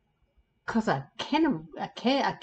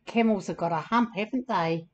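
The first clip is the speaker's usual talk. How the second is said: usual speaking voice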